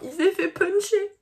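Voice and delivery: high, loud mock outrage; indignant outcry. Real, not acted. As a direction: exclaim it, outraged.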